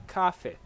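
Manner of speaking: "café" with the first syllable stressed. Shown as a demonstration. Teacher showing what not to say, "café" stressed on the first syllable. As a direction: punch the start of "café", let the end fall away. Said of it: "putting the stress here in the first syllable".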